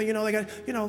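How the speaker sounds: normal talking voice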